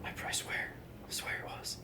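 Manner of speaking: hushed whisper; a guilty whisper. Pretend. Whispered joke.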